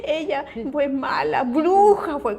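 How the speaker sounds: put-on childlike complaint.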